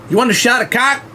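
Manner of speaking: mocking Southern holler asking a question; Southern twang on shot of cock, pitched high; Southern accent. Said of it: "Stevie Southern accent".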